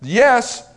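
high, loud, punched "Yes"; emphatic yes. Shown as a demonstration. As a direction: land the "Yes" as a final answer. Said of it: "yes is to be emphatic".

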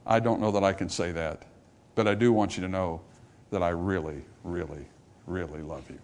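soft, low confession fading away; humble admission. Retold or imitated. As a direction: confess quietly, sinking almost to nothing. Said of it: "I should be able to say".

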